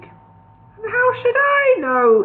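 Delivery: very high, slow sulky whine. A narrator's reading. Gandalf sulking at the dwarves' rebuke, high and slow. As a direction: sulk like a cornered old wizard. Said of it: "he asked sulkily".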